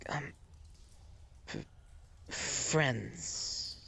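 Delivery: hesitant, soft, rising question; falter, then tentatively offer the word; really confused. Acted. Confused captive attempting sign language for friends, hesitant. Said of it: "She's really confused, but she's like".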